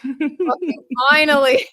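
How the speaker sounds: high, loud, drawn-out relief; re-enacted relieved sigh. Streamer recalling getting off stream, exaggerated relief. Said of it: "took the biggest sigh".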